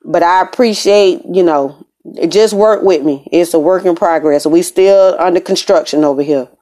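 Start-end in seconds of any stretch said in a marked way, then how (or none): none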